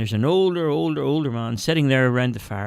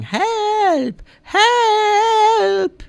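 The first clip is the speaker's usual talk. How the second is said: high, loud cries of help from afar; distant calls for help; 4 dB louder than usual. A narrator's reading. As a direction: call out high and desperate. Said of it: "he heard a voice calling".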